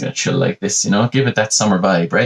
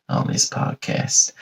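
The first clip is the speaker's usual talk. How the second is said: slow whispered enunciation; 6 dB quieter, slower than usual. Shown as a demonstration. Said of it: "talking slow and enunciate my words".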